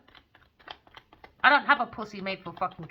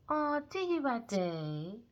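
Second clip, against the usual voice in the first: imitating two-faced rival; 3 semitones higher, 6 dB quieter, much slower than usual. Her hugging him in the chest, mock cooing imitation. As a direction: mimic her sweetly fawning, sliding down in pitch. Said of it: "She looked at".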